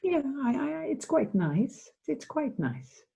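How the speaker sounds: high, slow, lightly voiced pleased client; a hint of a delighted client discovering comfort; client's pleased voice. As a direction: let the surprise of pleasure lift the voice. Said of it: "She said".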